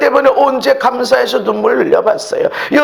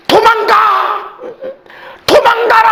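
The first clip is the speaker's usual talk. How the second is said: mother sheep crying out; 10 semitones higher than usual. Acted. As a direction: yell the warning like a mother saving her young. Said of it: "그것이 부르짖는 거야".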